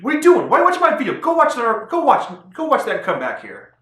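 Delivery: high, loud comic outburst; mock scolding shout. Vlogger sending viewers off to watch the cartoon, mock scolding. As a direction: yell at them in pretend exasperation.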